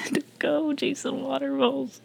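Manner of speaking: high, soft teasing plea; slow, high-pitched mock begging; mock pleading. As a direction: plead in a high little voice, amused. Laughing mock plea to Jason.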